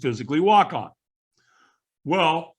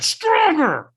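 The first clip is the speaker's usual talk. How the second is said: a full scream, much higher and louder; 7 dB louder than usual. Retold or imitated. Trainer screaming at him at the head of the fire lane, full scream. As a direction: scream it at him like a drill coach who has lost patience. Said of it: "So he screamed at me".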